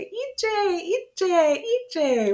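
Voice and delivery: high singsong wake-up call; waking a child. Pretend. Parent entering a child's room to wake them, calling out high. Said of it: "go in there and you say".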